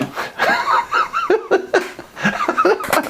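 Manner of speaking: high burst of laughter. Real, not acted. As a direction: break into a big laugh. Laughing at own prank story.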